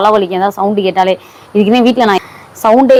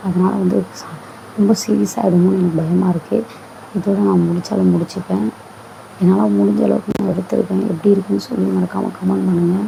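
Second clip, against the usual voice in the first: hushed talk; 8 dB quieter than usual. Real, not acted. Vlogger telling viewers his wife sleeps nearby, hushed. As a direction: speak softly beside a sleeping person.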